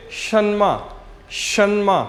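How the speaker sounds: शन्मा said slowly, each syllable clear; pronunciation demo. Shown as a demonstration. Teacher modeling शन्मा for students, careful pronunciation. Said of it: "कैसे pronounce करेंगे?".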